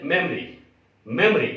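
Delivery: मेमोरी as MEM-ree, middle O dropped; correct pronunciation memory. Shown as a demonstration. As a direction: squeeze मेमोरी into two beats. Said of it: "ओ को silent कर देते हैं". (मेमोरी, बीच का ओ silent)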